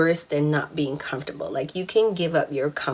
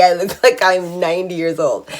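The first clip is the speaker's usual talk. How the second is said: ordinary talk, nothing marked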